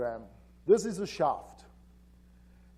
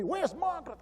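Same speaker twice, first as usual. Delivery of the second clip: retold agitated demand; 11 semitones higher than usual. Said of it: "he yells at me".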